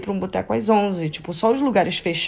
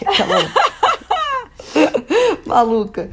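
high, loud burst of laughter; laughing; 12 semitones higher, 4 dB louder than usual. Real, not acted. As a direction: laugh through the words. Laughing at a friend's remark.

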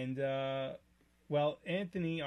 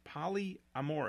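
"Polyamorous" stretched slowly, part by part; careful enunciation; slower than usual. Real, not acted. Host correcting co-host's wrong word, "Polyamorous" enunciated slowly. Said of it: "no, it's not polygamist".

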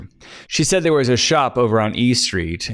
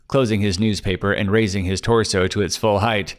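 ordinary delivery

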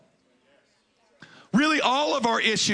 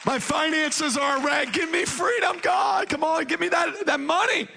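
mock shouted prayer demanding money; 6 semitones higher than usual. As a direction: shout it like a greedy prayer, hammed-up.

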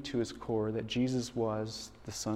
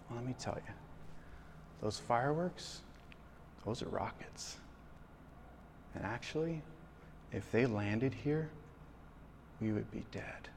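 calm father; 11 dB quieter than usual. Retold or imitated. Father calmly explaining rockets.

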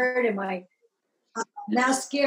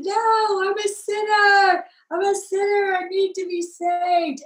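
high, loud pleading cry; desperate raised cry of repentance; 8 semitones higher, 5 dB louder than usual. Retold or imitated. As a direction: plead loudly that you're a sinner. Crying out her repentance at altar.

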